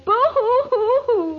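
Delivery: spelt-out mock sobbing; hammed-up boo-hoo crying; fake boo-hoo sob.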